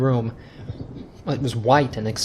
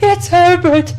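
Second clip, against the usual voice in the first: much higher, louder scream; 9 dB louder, slower than usual. Acted. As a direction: shriek for your son. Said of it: "she screamed".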